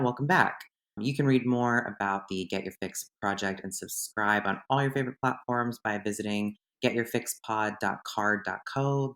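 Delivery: ordinary talk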